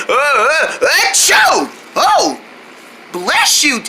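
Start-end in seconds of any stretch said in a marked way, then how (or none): 1.1-1.7 big high sneeze. Acted. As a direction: burst out a sneeze. Character sneezing loudly.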